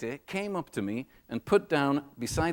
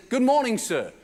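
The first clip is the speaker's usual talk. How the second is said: cheerful greeter; 9 semitones higher, 6 dB louder than usual. Retold or imitated. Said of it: "smiled, and he said".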